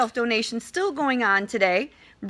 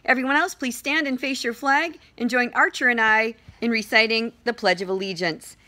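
ordinary talk, nothing marked

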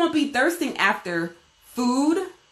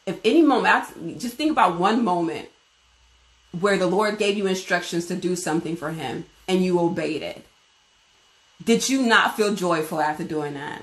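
ordinary delivery

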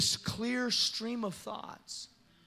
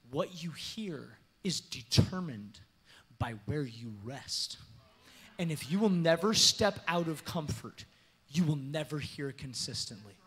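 ordinary talk, nothing marked

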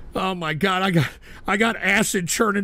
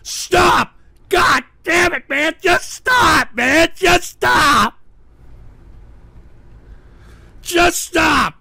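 loud, higher angry shouts of stop; furious yelling; 4 semitones higher, 5 dB louder, much slower than usual. Real, not acted. Streamer yelling at his chat to stop, furious. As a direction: blow up at them, fed up.

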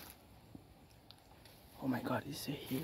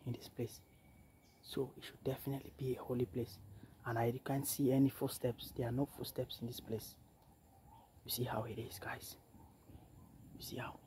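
much softer, hushed tour voice; hushed near the sleeping ancestors; 11 dB quieter than usual. Real, not acted. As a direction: keep it down, barely speaking. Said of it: "I don't wanna keep my voice very loud".

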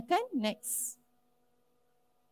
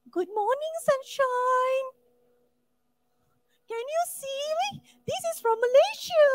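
much higher, sing-song mock greeting; annoying girl on the train; 13 semitones higher than usual. Teacher mocking a girl on a video call, high sing-song voice. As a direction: mimic the chatty girl on the train, high and sweet.

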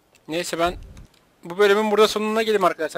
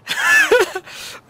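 high, squealing laugh; 3 dB louder than usual. Real, not acted. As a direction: crack up at your own impression. Taklidine gülüyor. (tiz kahkaha)

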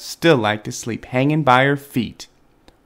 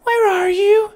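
very high, tiny squeaked question; thin high baby-animal squeak; squeaky baby bat; 3 dB louder than usual. A narrator's reading. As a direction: squeak out a lost baby's call. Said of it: "Stella Luna squeaked".

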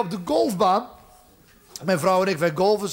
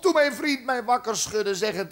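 ordinary delivery

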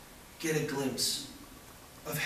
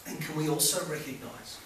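ordinary delivery

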